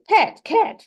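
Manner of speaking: high demo voice saying the words; teacher modelling word changes, high playful voice. Shown as a demonstration. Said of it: "so I say".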